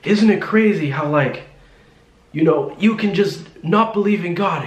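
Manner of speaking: high-pitched mock preaching rant; retold yelling. Imitating the driver arguing about God, mild mock yell. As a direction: imitate someone yelling about belief, at half force. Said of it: "He's yelling at me about God".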